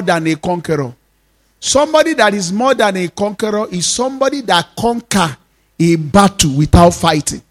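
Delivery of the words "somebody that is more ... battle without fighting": ordinary talk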